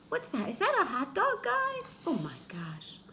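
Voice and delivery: squeaky high gasp of surprise; surprised. Acted. Reading to kids, amazed by the hot dog guy at the door. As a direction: act amazed at who's there.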